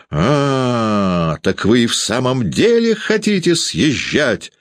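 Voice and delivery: high, drawn-out frenzied outcry; frenzied cry. A narrator's reading. Landlady confronting tenants about moving out, frenzied cry. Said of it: "неистово крикнула".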